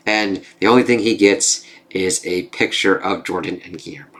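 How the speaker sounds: normal talking voice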